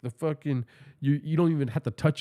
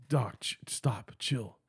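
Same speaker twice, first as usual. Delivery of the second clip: whispered, much softer warning; hushed whisper; 5 semitones lower, 10 dB quieter, much slower than usual. Retold or imitated. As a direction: hiss at him to calm down. Buddy hushes him in elevator.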